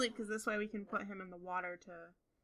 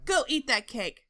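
loud, excited egging-on; urging on; 3 semitones higher, 8 dB louder, slower than usual. Real, not acted. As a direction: cheer them on with forceful, punchy commands. Cheering on a game character.

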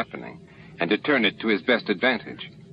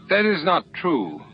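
blustering; 8 semitones higher than usual. A narrator's reading. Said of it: "Dran blustered".

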